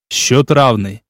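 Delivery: ordinary delivery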